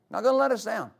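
ordinary talk, nothing marked

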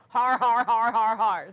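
loud, measured har-har laugh; mock belly laugh, dropping at the end; Brother Hagin's laugh. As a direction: imitate a preacher's hearty laugh, each har punched. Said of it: "And you hear Brother Hagin".

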